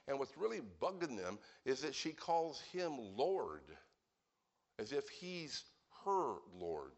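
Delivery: ordinary talk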